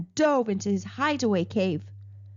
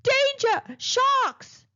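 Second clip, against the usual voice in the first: high alarm-like warning cry; shrill siren-like warning call; shrieking alarm; 3 dB louder, much slower than usual. Acted. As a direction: sound it like a blaring alarm. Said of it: "a loud warning shrieked".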